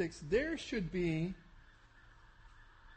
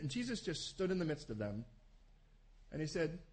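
ordinary talk, nothing marked

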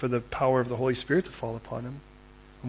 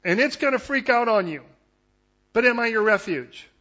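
voicing God in a raised, emphatic sermon voice; forceful, high-pitched assurance; impassioned preaching; 8 semitones higher, 5 dB louder than usual. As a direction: preach God's promise with urgency.

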